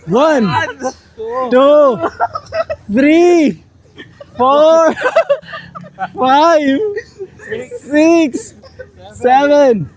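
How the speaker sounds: strained counting mid push-up; doing push ups. Real, not acted. Counting reps mid push-up set.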